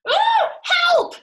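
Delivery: high-pitched, loud scream for help. A narrator's reading. Missus Twit finds something in her bed, screaming. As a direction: shriek it in panic. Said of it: "screamed Missus Twit".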